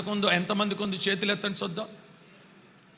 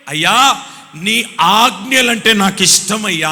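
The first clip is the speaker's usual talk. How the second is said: louder heartfelt cry; louder devoted plea; fervent psalmist; 7 dB louder than usual. Acted. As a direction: call out your love to the Lord. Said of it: "అని అంటున్నాడు".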